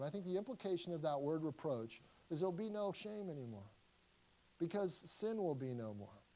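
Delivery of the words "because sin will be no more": ordinary talk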